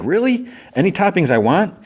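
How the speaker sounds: high-pitched, eager question; retold delight. Cake answers Worm's invitation to stay, acted delight. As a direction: imitate an eager, delighted guest.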